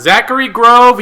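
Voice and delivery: high, loud name announcement; excited call-out. Pretend. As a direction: hail the coach by name. Calling out the drawn team's coach.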